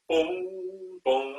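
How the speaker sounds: struck, ringing booms; tubular bells. Shown as a demonstration. Conductor imitating bells for basses, resonant tolling. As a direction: toll each boom and let it decay. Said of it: "like tubular bells".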